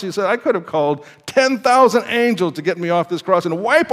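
high, forceful sermon delivery; impassioned preaching. Real, not acted.

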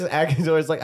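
usual speaking voice

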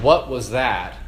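usual speaking voice

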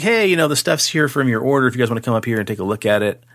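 ordinary talk, nothing marked

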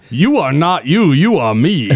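a botched attempt at Arnold; failed Arnold voice. Friend quoting the video message, a failed Arnold voice. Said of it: "That was not an Arnold voice".

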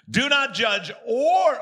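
"Do not judge, or" rising loud and forceful, "or" shouted high; strong scripture warning. Shown as a demonstration. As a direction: hit "Do not judge, or" hard, climbing onto "or". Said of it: "he's gonna get real strong here".

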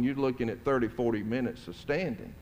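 usual speaking voice

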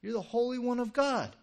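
high, loud accusing cry; demon's outcry. Acted. Demon saying it has identified Jesus, loud outcry. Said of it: "from that demon saying".

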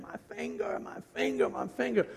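imitating himself moaning over a crushed finger, the first one a breathy gasp; mock pained moaning. Pastor retelling the moment his finger was crushed, mock moaning. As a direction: ham up the hurt, repeating it.

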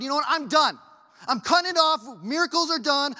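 loud, high-pitched mock declaration; exaggerated throwing-in-the-towel outburst; mock fed-up quitting. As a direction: announce it loudly, pretending to quit. Acts out God declaring he quits.

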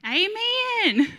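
high, loud, drawn-out call; imitated amen shout. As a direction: mimic locals joining in the yell. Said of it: "they started saying".

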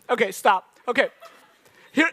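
ordinary delivery

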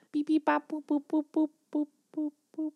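high beeping robot noises, fading out; beep-boop like a little robot winding down; toy robot voice. Pretend. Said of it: "that's my robot".

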